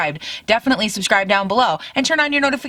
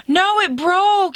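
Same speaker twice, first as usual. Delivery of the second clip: much higher, slow wail of dismay; dismayed cry; 10 semitones higher, slower than usual. Real, not acted. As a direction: cry out as the pencil snaps. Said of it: "I'm so sad".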